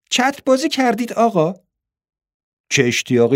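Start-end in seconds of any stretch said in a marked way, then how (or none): 0.1-1.5 put-on high-pitched, eager young girl; excited question in a girl's high voice. A narrator's reading. Girl asks the stranger about the parachute ride, eagerly.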